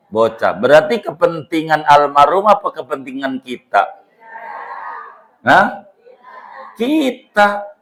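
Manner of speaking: high, loud, insistent sermon delivery; rising to full force, challenging the listeners; fervent preaching. Acted. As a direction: preach it hard, pressing every question on the crowd. Preacher rouses the congregation.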